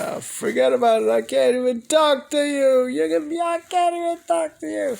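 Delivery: high, fed-up outburst at dad; exasperated. Retold or imitated. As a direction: throw up your hands in mock frustration. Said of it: "I'm like".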